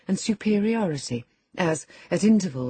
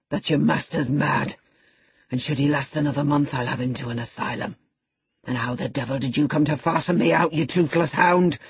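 harsh, scornful rough voice; angry Heathcliff. Acted. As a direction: bark at the old servant. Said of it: "thundered Heathcliff".

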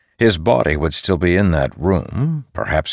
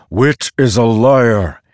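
slow, loud, raised denunciation; angry retort; 6 semitones higher, 6 dB louder, slower than usual. A narrator's reading. Storm god warning Dalinar against Wit, angry. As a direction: denounce him slowly and forcefully.

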